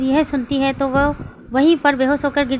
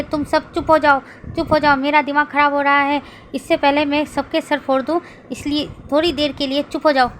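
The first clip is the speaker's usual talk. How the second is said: usual speaking voice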